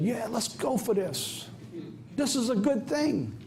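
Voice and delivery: high, slow, mocking voice; mock Congress. Preacher mocking Congress people's approval, slow and high. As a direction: ham up the foolish approval.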